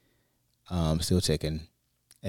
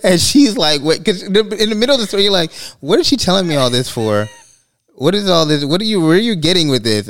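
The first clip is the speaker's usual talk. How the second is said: high, louder laughing retelling; husband laughing as he retells his wife's confusion, high and louder; laughing while telling; 12 semitones higher, 10 dB louder than usual. Real, not acted. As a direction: tell it while cracking up. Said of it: "you're laughing".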